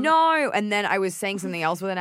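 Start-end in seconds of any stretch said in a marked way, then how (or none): 0.0-0.5 high, loud Australian No; Australian accent. Shown as a demonstration. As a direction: exclaim No in broad Australian. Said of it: "saying no as an Australian".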